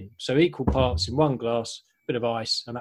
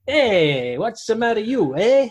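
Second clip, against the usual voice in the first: put-on higher, louder comic Italian accent; mock Italian accent, pitched up; hammed-up Italian accent; 8 semitones higher, 4 dB louder, slower than usual. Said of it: "an Italian came back from his travels and tasted this and said".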